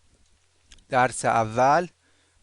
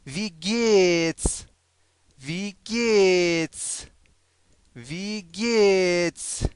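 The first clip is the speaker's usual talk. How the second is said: "وی گیتس" higher, with long stretched vowels; very slow model; 4 semitones higher, 4 dB louder, much slower than usual. Shown as a demonstration. Teacher drills German how-are-you, "وی گیتس" slow and higher. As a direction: draw out "وی گیتس" for repetition.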